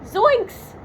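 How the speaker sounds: high yelp of fright; startled yell. Acted. Shaggy sees the mummies again, panicked high yell. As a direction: cry it out in panic. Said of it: "yelled Shaggy".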